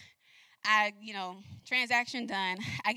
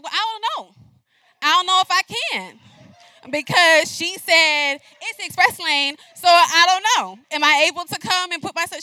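loud, insistent, high and pushy; pushing back hard at the cashier; defensive loud; 7 semitones higher, 13 dB louder than usual. Retold or imitated. Shopper loudly arguing with the Publix cashier, pushy. Retold argument at express lane.

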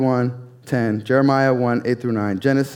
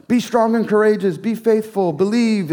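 higher, mocking pious platitudes; mock Christianese; 9 semitones higher, 3 dB louder than usual. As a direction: rattle off churchy clichés mockingly. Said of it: "just start using Christianese".

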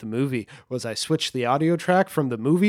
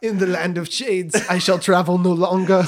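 Herzog impression; 5 semitones higher, 4 dB louder than usual. Continuing Herzog voice.

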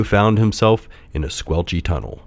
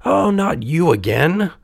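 high, exasperated puppet voice; puffing puppet; 10 semitones higher than usual. A narrator's reading. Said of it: "puffed Pinocchio".

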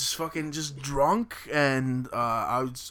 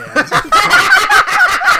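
high burst of laughter; shrieking laugh; loud shrieking laugh; 16 dB louder than usual. Real, not acted. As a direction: crack up loudly. Laughing at own story.

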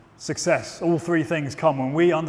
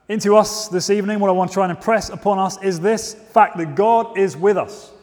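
ordinary talk, nothing marked

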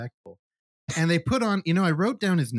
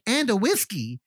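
lightly voiced higher kid voice; child impression; 7 semitones higher than usual. Kid tattles on drinking Dad, lightly imitated child. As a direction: mimic a kid chiming in on Dad.

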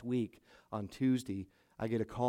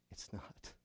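nearly whispered "it's not"; a soft, breathy "it's not" to a dying man; hushed; 16 dB quieter than usual. Retold or imitated. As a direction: let it fall to a whisper. Pastor tells dying man, hushed.

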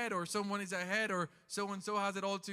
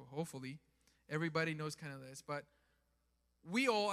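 ordinary delivery

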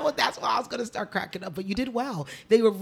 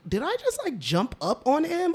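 high-pitched questioning of herself; mock-shocked self-questioning; retold disbelief; 8 semitones higher than usual. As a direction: replay your own startled second thought, pitched up. Retelling her embarrassed disbelief at herself.

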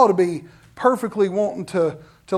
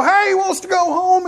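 calling out; 8 semitones higher than usual. Retold or imitated. Grandpa shouting to catch Dad.

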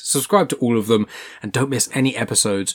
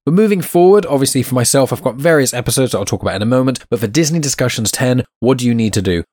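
louder, upbeat host wrap-up; podcast outro; 5 dB louder than usual. Acted. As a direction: sign off the show like a presenter.

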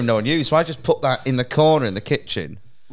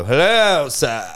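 posh neighbour; much slower than usual. Retold or imitated. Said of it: "He was so posh".

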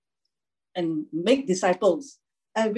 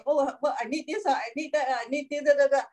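high, fretful list of needs; mock fussy worrier; 7 semitones higher, faster than usual. To the congregation, mocking our worry: high fretful whine. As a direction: mimic a fussy believer listing gear. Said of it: "So we think".

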